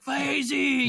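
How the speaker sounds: mock crowd cheer; cheering name. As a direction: shout it like a crowd erupting. Crew erupts cheering guest.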